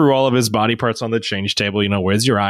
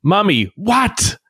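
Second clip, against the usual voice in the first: imitated toddler yell; 8 semitones higher, slower than usual. Dad imitating his son yelling for Mummy, mild mock yell. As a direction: imitate a child calling out, pitch jumping up on "what". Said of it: "and he starts yelling".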